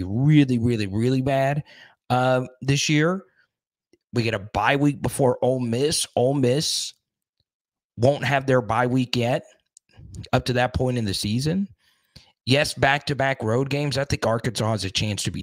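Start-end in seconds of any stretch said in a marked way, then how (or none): none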